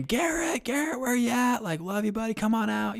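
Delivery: imitated far-off search call, pitched very high, no real volume; high, held-back pretend holler for a lost boy; mock yelling. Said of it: "They're yelling his name".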